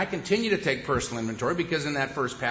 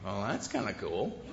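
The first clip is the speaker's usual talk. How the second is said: softer, lower marvel; quiet musing; 7 semitones lower, 10 dB quieter than usual. Retold or imitated. Man watering plants, marvelling softly at the sparkle. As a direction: think it quietly to yourself. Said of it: "I'm thinking".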